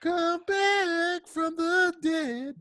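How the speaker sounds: spooky high voice. Pretend. Mock horror movie voice.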